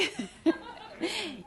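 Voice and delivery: soft burst of laughter; real laughter. Real, not acted. Preacher finishing her crossing story, laughing. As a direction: laugh before the punchline.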